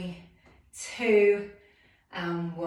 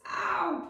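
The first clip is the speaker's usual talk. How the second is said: sharp high ow; ow of pain; 10 semitones higher than usual. Real, not acted. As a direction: yelp from the burn. Pain during pulses.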